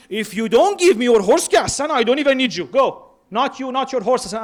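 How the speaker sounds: imitating an angry, rejecting Hussein; angry dismissive tone. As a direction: throw him out in a harsh retold tirade. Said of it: "Why do we change the tone of Hussein from ... to".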